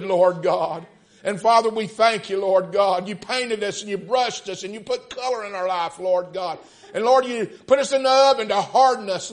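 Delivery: loud, high preacher's prayer; fervent prayer. Real, not acted. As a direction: cry out to God in impassioned revival prayer. Leads fervent public prayer.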